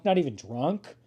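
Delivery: ordinary talk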